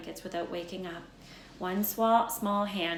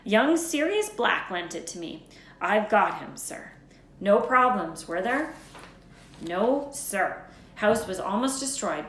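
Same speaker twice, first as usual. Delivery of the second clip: Hagrid voice; 8 dB louder than usual. Acted. Hagrid voiced in read story.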